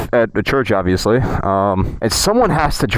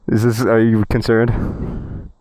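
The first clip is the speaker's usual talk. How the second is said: normal talking voice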